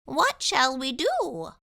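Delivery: a tiny polite mouse dentist; little mouse speaking up. A narrator's reading.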